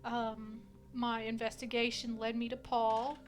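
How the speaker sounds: slow, deliberate testimony with long pauses; solemn witness. Acted. Murdered woman testifies to the judge, solemn and measured. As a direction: recount it formally, piece by piece.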